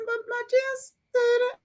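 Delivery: put-on high, innocent toddler voice; little girl voice. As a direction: sound like a small child protesting. Voicing a three-year-old.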